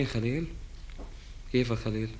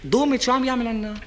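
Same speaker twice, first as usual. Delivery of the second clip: excited ranting; 7 semitones higher than usual. Acted. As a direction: rant about the tank, voice pitched up. Ranting about water tank, high. (نقّ متحمس بصوت عالٍ ومرتفع)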